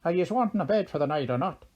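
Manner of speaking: fast, high, loud Irish leprechaun; very broad Irish accent. A narrator's reading. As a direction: fire the question off in a thick Irish brogue. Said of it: "in a very broad Erin accent".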